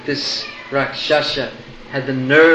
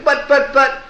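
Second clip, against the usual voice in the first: flustered sputter; 12 semitones higher, 5 dB louder than usual. Acted. Shrinking demon sputters.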